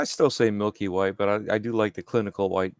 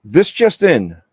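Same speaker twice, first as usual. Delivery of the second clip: news anchor; 3 semitones higher, 8 dB louder than usual. Pretend. Fake breaking news announcement.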